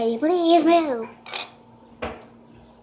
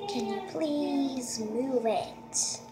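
softer, slow, gentle plea; quiet asking; 9 dB quieter, much slower than usual. Shown as a demonstration. Kid coaxing the ghost, asking quietly and slowly. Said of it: "I'm asking you quietly".

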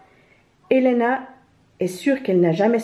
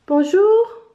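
high, louder hello lifting at the end; greeting; 12 semitones higher, 6 dB louder than usual. A narrator's reading. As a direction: call out a bright-pitched first hello, rising. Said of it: "répète Elena". (salut lancé d'une voix aiguë)

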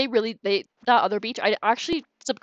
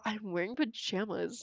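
soft, slow, flat-faced "I'm wearing pajamas"; mock P G's matter-of-fact line, quietly and slowly; deadpan imitation of P G; 9 dB quieter, slower than usual. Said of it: "the way P G is just, like, straight up".

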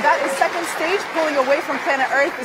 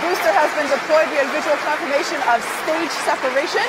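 excited; 4 semitones higher, 4 dB louder than usual. Real, not acted. Host calls stage separation.

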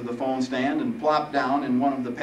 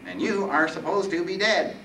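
high, whiny insistence; whining Reaper; 9 semitones higher, 4 dB louder than usual. Acted. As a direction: insist on your title in a nasal whine. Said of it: "the voice whined".

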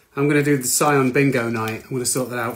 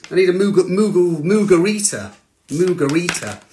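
excited; 4 semitones higher, 5 dB louder than usual. Real, not acted. Playful Moogle chant.

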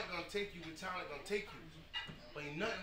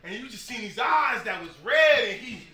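rising excitement; 5 semitones higher, 14 dB louder than usual. Real, not acted. Building excitement retelling breakthrough.

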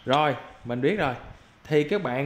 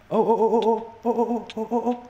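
rising high repeated ô; climbing higher with each cry; startled yelps; 7 semitones higher than usual. Real, not acted. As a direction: squeal as you get ambushed. Gamer reacting to sudden death.

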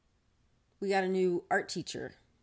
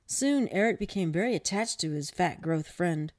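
louder, animated read-aloud; story narration; 6 dB louder than usual. Acted. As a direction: project it like a storyteller. Reads a short story aloud.

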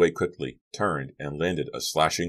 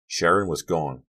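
ordinary delivery, nothing marked

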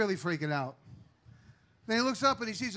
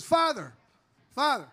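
calling out; 5 semitones higher, 4 dB louder than usual. Acted. Calls out to passing priest.

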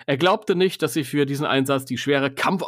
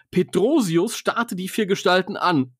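plain reading voice

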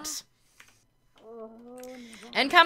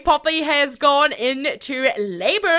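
ordinary talk, nothing marked

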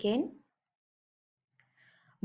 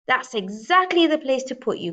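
higher, louder gruff threat; growling bear; 6 semitones higher, 7 dB louder than usual. Acted. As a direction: growl it out with satisfaction. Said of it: "growled Bear".

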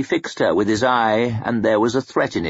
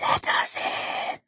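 slow, eerie whisper from the void; ghostly whisper; 8 dB quieter, slower than usual. A narrator's reading. Voices in her dream whisper to be let in, slow and eerie. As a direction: breathe it like voices beyond the glass. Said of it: "a bottomless void filled with voices".

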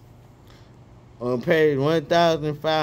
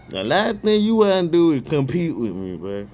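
ordinary talk, nothing marked

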